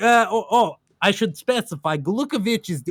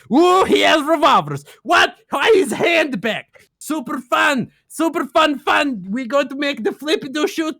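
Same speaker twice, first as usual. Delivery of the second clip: excited; 5 semitones higher than usual. Real, not acted. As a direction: gush like a delighted fan. Gleeful retelling of Ocelot's entrance.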